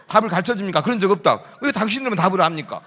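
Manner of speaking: loud acted phone dispute; loud interrogation. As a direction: fire the questions loudly, imitating an interrogating officer. 경찰과 학교의 전화 실랑이. (따져 묻는 경찰을 크게 흉내)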